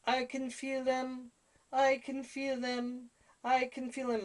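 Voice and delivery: high, slow, loud chanting of a trance medium; aunt in a trance. Retold or imitated. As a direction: intone it like a medium sensing spirits. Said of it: "my aunt's going".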